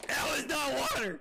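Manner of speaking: high, loud yell; shocked protest. Real, not acted. Streamer tricked about a drink, loud shocked protest. Discovers the drink was alcohol.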